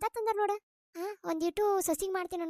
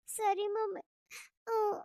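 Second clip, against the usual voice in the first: higher, tearful voice; crying; 5 semitones higher, much slower than usual. Acted. Daughter agreeing with her mother before leaving, crying. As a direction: agree through tears. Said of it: "ಎದಕ್ ಅಳ್ತಿ?".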